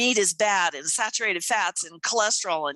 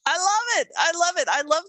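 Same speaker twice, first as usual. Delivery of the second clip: delighted; 10 semitones higher, faster than usual. Real, not acted. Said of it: "enthusiastic repeat".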